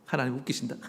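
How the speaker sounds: acted chuckle, low and soft; scornful private laugh. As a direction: laugh to yourself as Abraham doubting God. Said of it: "속으로 웃었는데".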